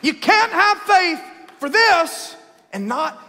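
climbing preacher cry, rising to a shout; sermon climax building to a shout; preaching build. Acted.

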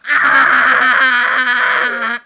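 sudden loud panicked scream. Real, not acted. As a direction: shriek in panic. Panicked at tsunami behind her.

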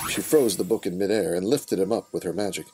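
ordinary delivery

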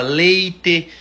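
"leite" said with a clear closed final "e", never "i"; final e kept. Shown as a demonstration. Showing how Manoel Ribas folk say it, slow "leite" with closed final e. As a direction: slowly say "leite" ending on a full "e" sound. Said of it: "lá o povo fala".

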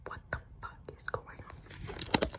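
slow, soft frightened whisper; scared whisper. Real, not acted. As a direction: breathe it out in fear. Frightened during horror teaser.

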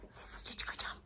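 breathless unvoiced whisper; whisper, sneaking out; whispering while hiding. Sneaking out past zombie.

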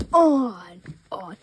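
jump-scare yell after the whisper; loud startling outburst; sudden shout. Acted. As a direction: snap the word out at full volume. Attendant repeats the rule.

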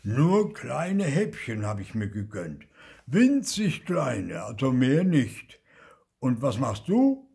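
loud, emphatic self-defence; stern Onkel Oskar. A narrator's reading. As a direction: justify yourself, then turn on the accuser. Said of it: "Oskar sah seinen Neffen streng an".

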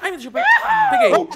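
high laughing delight; excited laugh. Pretend. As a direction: squeal with joy while laughing. Catches trident, laughing.

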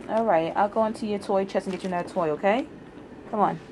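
high, soft pet talk. Real, not acted. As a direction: coo at the cat. Soothing the cat.

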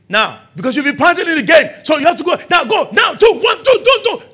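loud, high counting cadence; coach counting shouts. Retold or imitated. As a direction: push him through the last reps. Counting player through drill.

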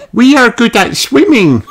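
put-on very high child character voice; Rebecca. A narrator's reading. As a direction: pipe it high and proud. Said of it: "says Rebecca".

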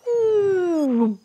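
long, high ooh gliding down; drawn-out sound effect; door-opening noise. Pretend. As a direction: voice a door swinging open with a sliding hoot. Mimes cupboard door opening.

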